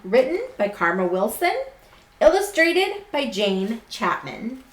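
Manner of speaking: slow, lilting storybook voice; reading to children. A narrator's reading. Teacher reading a picture book to her class, gently storytelling. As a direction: read it like bedtime story time.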